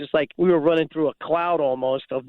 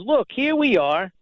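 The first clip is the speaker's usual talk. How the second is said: mimicking Drew; 6 semitones higher, slower than usual. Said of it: "He goes".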